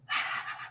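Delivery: soft, breathy exhale; real releasing sigh while exercising; audible sigh. Real, not acted. Breathing while leading a shaking practice.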